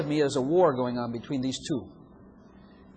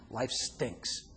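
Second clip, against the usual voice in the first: grumbler; 6 semitones lower, 7 dB quieter than usual. Acted. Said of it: "This one over here saying".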